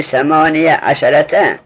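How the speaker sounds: ثمانیه عشرته with an open "-ya" before عشرته; fatha on the ya. Shown as a demonstration. Teacher reading the rule to students, fatha on the ya. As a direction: say ثمانیه عشرته slowly, the ya voweled "a".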